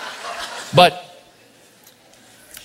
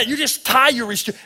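normal talking voice

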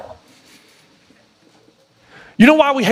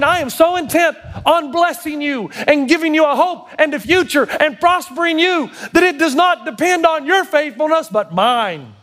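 higher, ringing promise of blessing; exultant God voice; 8 semitones higher than usual. Acted. Preacher to the congregation, voicing God with exultant joy. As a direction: proclaim it with full joy.

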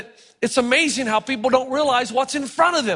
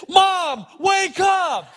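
calling out; 7 semitones higher, much slower than usual. Retold or imitated. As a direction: shake her awake with a shout. Calling out to sleeping mother.

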